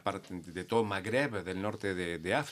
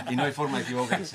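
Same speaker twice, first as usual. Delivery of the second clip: laughing while explaining; 3 semitones higher, 5 dB louder, faster than usual. Real, not acted. Laughing after joke punchline.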